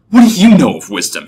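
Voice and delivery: loud, high scornful challenge; demanding. A narrator's reading. Entrant snaps back at the clerk, demanding. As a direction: throw the question back loudly. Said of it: "he demanded".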